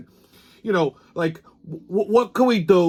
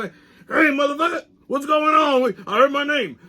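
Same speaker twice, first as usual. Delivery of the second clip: high-pitched put-on sidekick voice; Pops; 8 semitones higher than usual. Sidekick hearing his name, brash high character voice. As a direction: butt in as the brash, foul-mouthed sidekick, pitched up. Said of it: "I consulted my trusty sidekick, Pops".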